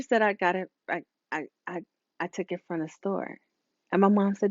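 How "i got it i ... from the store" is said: much softer, stammering admission; child admitting the theft to her mother; timid confession. Retold or imitated. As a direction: confess meekly to mom. Sister confessing to mom.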